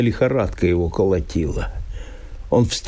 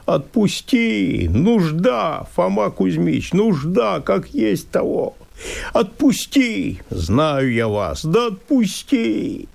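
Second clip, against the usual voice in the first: высокий, жалобно умоляющий голос; проси отпустить, со слезой в голосе; мольба; 7 semitones higher, slower than usual. Acted. Мужик умоляет лесника. (жалобная мольба мужика к леснику)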